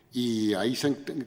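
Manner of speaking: ordinary delivery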